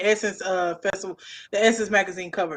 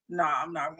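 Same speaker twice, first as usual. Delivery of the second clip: ordinary talk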